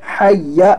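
"हय्या" with a held, pressed yy; firm doubled y. Shown as a demonstration. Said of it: "हय्या नहीं पढ़ना है".

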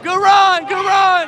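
loud, high cheer; cheering shout. Real, not acted. Cheering a teammate's run at the lanes, loud high shout. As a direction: yell it across the lanes.

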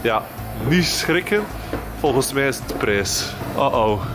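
ordinary talk

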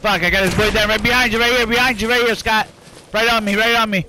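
urgent shouted callouts, high and loud; combat callout. Real, not acted. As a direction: bark out enemy positions fast.

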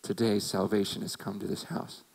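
Jesus declaring salvation to Zacchaeus; calm Jesus. Retold or imitated. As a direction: say it gently with authority. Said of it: "And what does Jesus say?".

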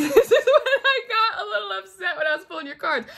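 laughing through the words, pitch high; can barely get it out for laughing; laughing while telling. Real, not acted. Reader confesses to viewers, laughing as she speaks.

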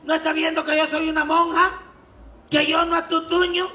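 a shrill, offended rebuke; indignant nun. Acted. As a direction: scold the drunk like an outraged nun. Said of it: "Dice la monja".

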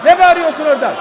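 loud indignant yelling; angry shout demanding the floor; real angry shouting. Real, not acted. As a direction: yell back at the heckler. Heated council row, shouting.

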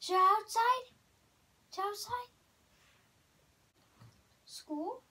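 talking to a dog. Real, not acted. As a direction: tease the dog with excited questions. Exciting dog with words.

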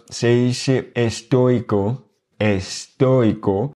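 "estoico" said slowly, stress on "toi"; slow model pronunciation. Shown as a demonstration. Teacher slowly models "estoico" with the answer. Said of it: "On va juste dire estoico sans accent".

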